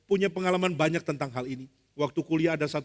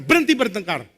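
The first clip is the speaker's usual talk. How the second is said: mock loud order, voice raised; commanding; 3 semitones higher, 7 dB louder than usual. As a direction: play a leader halting a quarrel. Moses ordering quarrellers to stop. (memerintah dengan suara keras)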